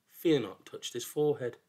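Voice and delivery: ordinary delivery, nothing marked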